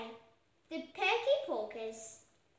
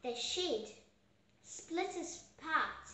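ordinary delivery, nothing marked

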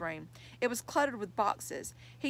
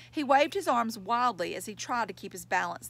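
ordinary delivery

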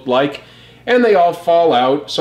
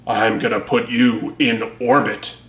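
lower, softer hero voice; Optimus Prime's voice; 5 semitones lower, 4 dB quieter than usual. Acted. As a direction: growl it low like a calm cartoon hero.

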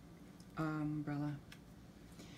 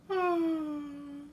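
gasp; 10 semitones higher, 4 dB louder than usual. Acted. Mama yawns, acted.

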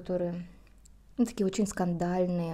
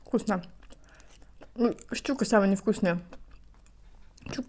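talking while chewing food; muffled words between bites; mouth full, chewing; 2 semitones higher than usual. Real, not acted. Seen 0: Lips closed and working after seaweed bite; the sushi is only sniffed.

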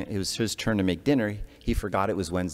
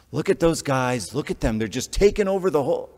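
mock disgusted rant; 7 semitones higher, 6 dB louder than usual. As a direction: sneer it out like a fed-up parent. Mocking parent ranting at TV.

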